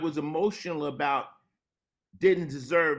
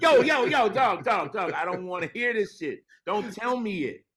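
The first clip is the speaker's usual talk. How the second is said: alarmed protest; 7 semitones higher than usual. Retold or imitated. Rapper to friend bragging of a shooting, alarmed protest. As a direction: act out stopping someone before they say too much. Cutting off friend confessing a crime.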